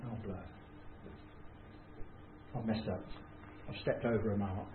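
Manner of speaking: muttered regret. Retold or imitated. Inner thought after offending Val.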